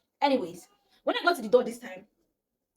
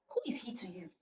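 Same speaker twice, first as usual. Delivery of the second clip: much softer, guarded question; hesitant girl's question; 3 semitones lower, 12 dB quieter than usual. Retold or imitated. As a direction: quietly venture it, having just found the courage. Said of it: "finally mustered up some courage".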